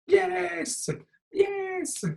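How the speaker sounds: high excited yes; excited squeal. Real, not acted. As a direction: squeal it out with joy.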